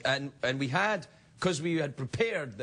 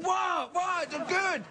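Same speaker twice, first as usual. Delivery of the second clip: high, shouted what, then a baffled defence; puzzled protest; much slower than usual. Retold or imitated. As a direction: insist it's fine, bewildered. Protesting that the cornflakes are fine.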